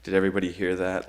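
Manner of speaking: ordinary talk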